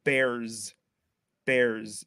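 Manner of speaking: BEARS with a voiced Z on the end. Shown as a demonstration. Showing what you actually say, BEARS ending in Z. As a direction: let the end of BEARS buzz as z. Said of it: "There's a, a Z sound on there".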